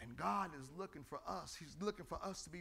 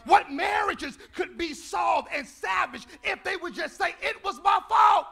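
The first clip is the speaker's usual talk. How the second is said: preaching shout; 11 semitones higher, 17 dB louder than usual. Acted.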